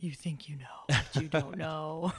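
soft, teasing put-on voice; playful put-on voice. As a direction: tease in a silly, hushed character voice, drawing out the end. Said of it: "do it in that voice".

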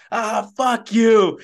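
loud, high, drawn-out mock yell; retold yell. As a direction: imitate a runner yelling at his cramping leg. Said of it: "yelling at his hamstring".

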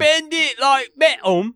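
hammed-up Cockney accent; mock Cockney accent. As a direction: drag out a cartoon Cockney voice.

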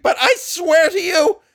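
very high and loud, crying out; shrieking cry. Acted. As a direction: scream the oath in terror. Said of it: "voice had risen to a shriek".

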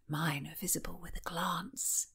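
soft, whispered tease; hushed playful aside; teasing whisper. Acted. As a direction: breathe the joke right in her ear. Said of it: "Mary teased".

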